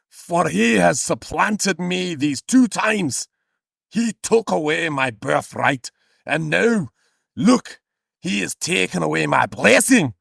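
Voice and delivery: high, slow theatrical lament; hammed-up wronged son, pleading and aggrieved; emotional. Esau protesting his brother's theft, mock-emotional declamation. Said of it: "he's getting a bit emotional".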